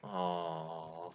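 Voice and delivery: soft, low drawn-out aww; sympathetic studio audience. Acted. Narrator playing the audience as Luigi leaves, soft sympathetic aww. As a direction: mimic a sympathetic sitcom audience. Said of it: "as the studio audience goes".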